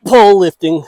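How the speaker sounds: loud, high effort yell while lifting; straining shout. Real, not acted. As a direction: grunt it out under heavy strain. Straining to tilt heavy pole.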